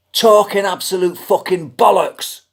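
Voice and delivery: loud, high-pitched dismissal; exasperated shout. Real, not acted. Customer condemning the last live-chat agent, exasperated shout. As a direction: belt out the verdict, every word pushed hard.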